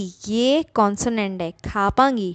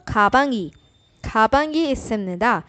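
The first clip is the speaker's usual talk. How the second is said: खापांगी run together fast, as one word; quick, joined. Shown as a demonstration. Teacher showing students the fast, joined खापांगी. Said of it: "एक साथ speed में जल्दी से बोलेंगे".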